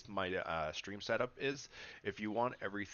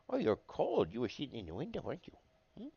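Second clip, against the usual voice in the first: cooing to cat; 10 semitones higher, 6 dB louder, faster than usual. Real, not acted.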